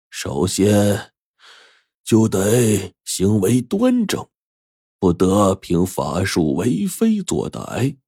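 put-on slow, measured voice of an old master; old master's voice. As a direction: lay down the rules slowly and gravely. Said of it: "油灯法师说".

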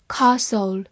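"Castle" British-style, long back a; British accent. Shown as a demonstration. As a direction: give the British KAH-sul for "Castle". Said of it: "美式发音".

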